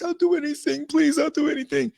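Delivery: high mock plea, on her knees; begging woman. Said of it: "when she was begging".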